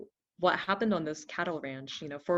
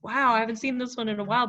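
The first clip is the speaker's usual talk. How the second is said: surprised wow; 6 semitones higher, 7 dB louder, faster than usual. Retold or imitated. Retold own surprised reaction.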